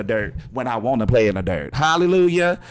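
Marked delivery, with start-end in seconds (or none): 1.7-2.6 louder, higher Hallelujah; joyful shout of hallelujah; praise exclamation. Real, not acted. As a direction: throw it out like church praise. Exclaiming praise mid-talk.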